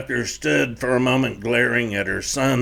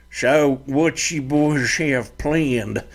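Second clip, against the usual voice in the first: toothless stepfather; 3 semitones higher, slower than usual. Acted. Stepfather asks the boys.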